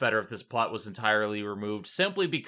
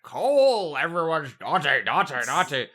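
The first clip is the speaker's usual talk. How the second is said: loud mock yell that fades into a hint of it; imitating Santa scolding Cole, a shout easing off; imitated Santa shout; 2 semitones higher, 5 dB louder than usual. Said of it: "then he just shout out".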